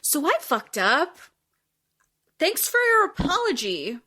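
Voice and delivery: dry mock thanks, pitch up; sarcastic retort. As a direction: throw the apology back sarcastically. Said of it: "Kate's like".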